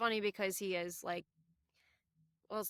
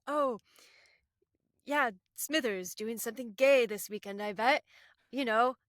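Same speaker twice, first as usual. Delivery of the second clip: Mr. Burns voice; 3 semitones higher, 10 dB louder than usual. Retold or imitated. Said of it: "he's like".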